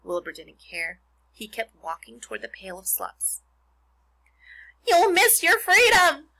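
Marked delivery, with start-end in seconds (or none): 4.9-6.3 high, loud, slow honk; honking goose. A narrator's reading. Goose warns pig about losing freedom, honking. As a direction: honk the warning slowly and loudly. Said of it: "honked the goose".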